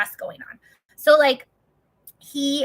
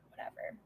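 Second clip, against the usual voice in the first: resigned sigh; 21 dB quieter than usual. Real, not acted.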